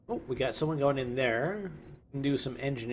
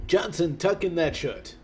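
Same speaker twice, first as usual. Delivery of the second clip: badly faked British accent; like a kid attempting a British accent; 3 semitones higher, 3 dB louder than usual. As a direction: amateurish British accent, ordering someone about. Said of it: "what accent that's supposed to be".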